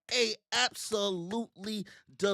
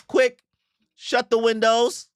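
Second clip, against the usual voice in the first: higher, louder hurried command; urgent mock alarm; 5 semitones higher, 6 dB louder, slower than usual. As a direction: call out urgently in jest. Urgent command in voiced scene.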